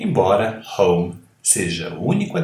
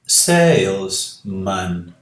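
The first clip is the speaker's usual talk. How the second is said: "salesman" drawn out slowly; teacher slowing down for students; slow model word; 3 semitones lower, much slower than usual. Shown as a demonstration. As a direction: stretch "salesman" syllable by syllable. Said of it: "vejam aqui que eu digo".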